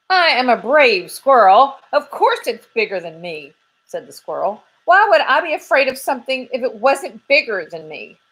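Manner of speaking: a hint of a high squirrel voice; a squirrel puffing himself up; brave squirrel. Squirrel insisting to Dirt he is brave, lightly high.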